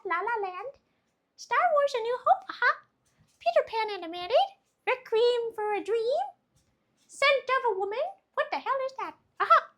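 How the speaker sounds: mystery squeaky voice she can't name; strange silly voice. Real, not acted. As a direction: read the titles in a goofy high voice. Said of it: "I don't know what this voice is".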